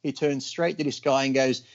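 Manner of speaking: ordinary talk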